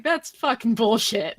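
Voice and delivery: put-on slow, lightly snarky kid retort; snarky seven-year-old. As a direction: play a kid talking back, drawn out. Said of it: "The snarky seven-year-old's like".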